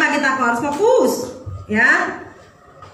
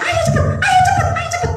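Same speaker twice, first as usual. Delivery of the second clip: much higher, shrieking "ayo cepet" over and over; frantic cheering yell. Retold or imitated. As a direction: scream for your child from the stands. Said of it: "saya tuh teriak-teriak".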